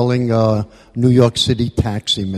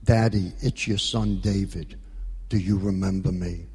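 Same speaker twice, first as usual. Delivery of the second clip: little voice; 4 semitones lower, 8 dB quieter than usual. Retold or imitated. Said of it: "the voice said".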